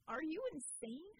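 outraged demand. A narrator's reading. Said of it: "Acrobat demanded".

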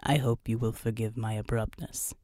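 very low, soft machine voice; synthesized voice. Acted. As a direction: apologize as a formal synthesizer, pitched right down. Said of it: "said the synthesized voice".

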